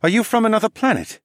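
high-pitched, incredulous questioning; astonished man with a French tinge; stunned engineer. Acted. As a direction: ask in disbelief, voice pitched right up.

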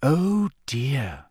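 mild dismay. A narrator's reading. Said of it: "said Hermione as she spotted Winky".

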